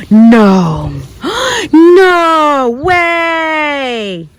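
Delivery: sharp gasp, then a loud high cry of no way; shocked by what she finds in the dirt; gasping disbelief. Real, not acted. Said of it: "I am so sorry if that was really loud".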